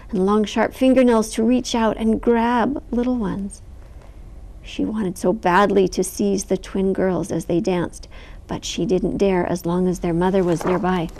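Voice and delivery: ordinary delivery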